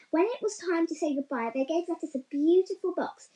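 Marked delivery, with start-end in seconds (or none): none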